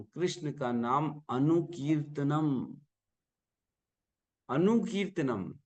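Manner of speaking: अनुकीर्तनम drawn out slowly, then repeated on its own; stress on अनुकीर्तनम. Shown as a demonstration. Teacher to devotees, slowly stressing अनुकीर्तनम. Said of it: "अनुकीर्तनम कहा".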